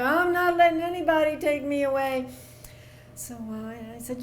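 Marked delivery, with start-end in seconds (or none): 0.0-2.3 whiny voice. Retold or imitated. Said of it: "he had this whiny little voice".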